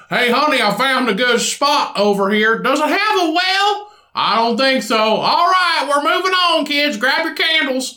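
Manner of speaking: rally the family to move on. Retold or imitated.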